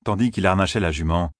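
plain reading voice

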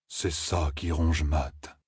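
slow low murmur. A narrator's reading. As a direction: murmur it softly, slowly. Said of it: "murmura Loyal".

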